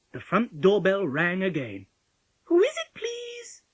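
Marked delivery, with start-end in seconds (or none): 2.5-3.6 put-on very high, slow child's call. A narrator's reading. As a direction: sing out politely, drawn out. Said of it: "called Polly through the letterbox".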